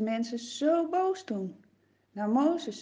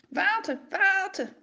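men demanding water, raised up; calling out; 8 semitones higher than usual. Acted. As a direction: ruwe mannen roepen om water. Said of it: "beginnen een paar mannen te roepen".

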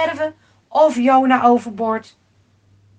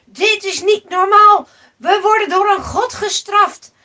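raised, high voice over the waves; alarmed calling out; 6 semitones higher, 6 dB louder than usual. A narrator's reading. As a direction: call out over a roaring storm. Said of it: "ze riepen tegen elkaar over het bulderen van de golven". (geschrokken roepen boven de golven uit)

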